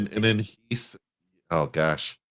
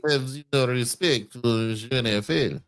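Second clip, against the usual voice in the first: put-on slow, lightly French-accented reading; mock French accent; 3 semitones higher than usual. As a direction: drag the line out in a hint of a French accent. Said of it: "His French accent".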